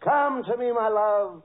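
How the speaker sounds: imitated seductress. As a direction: call a lover ardently, hammed-up. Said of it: "Marilyn looks into your eyes and says".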